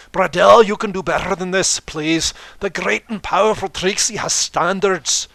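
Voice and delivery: loud, high, theatrical third-person boast; booming grandstanding showmare voice; boastful showman Trixie. Acted. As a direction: proclaim it like a stage magician showing off.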